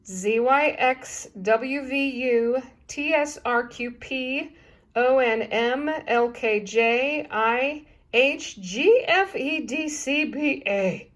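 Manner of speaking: slow, deliberate letter by letter; slow backwards alphabet. Shown as a demonstration. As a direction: spell each letter out slowly. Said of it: "here it is again in case you want to stop your video".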